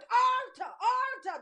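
shouted high, "Altar, altar"; loud call to the altar. Acted. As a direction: cry out to the altar with force. Preacher voicing man calling altar.

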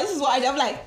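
normal talking voice

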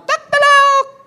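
shrill cock-a-doodle imitation, very high and loud; the rooster crowing over Peter's denial. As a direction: crow like the rooster at dawn. The rooster crows again.

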